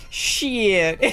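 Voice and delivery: high, stretched Southern "Shit", like "shee-it"; hammed-up Southern accent. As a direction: drawl "Shit" long in Southern style. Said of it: "is one of 'em".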